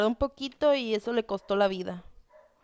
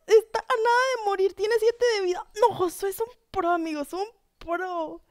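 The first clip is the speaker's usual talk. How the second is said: high breathless-with-awe commentary, settling gradually; excited high voice, easing down; amazed excitement; 8 semitones higher than usual. Real, not acted. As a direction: marvel at a narrow escape, pitched high. Amazed at narrow survival.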